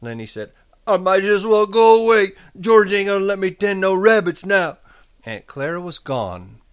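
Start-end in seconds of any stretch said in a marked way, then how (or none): 0.9-4.8 put-on high, loud, childlike lament; distressed simple man. A narrator's reading. Lenny despairing to his aunt, high distressed voice. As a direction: give up in a high, raised voice.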